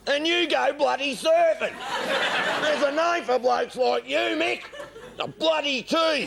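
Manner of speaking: high, laughing mock indignation; mock outrage. To Mick, high laughing mock outrage at the tease. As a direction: squeal it out, scandalised and giggling.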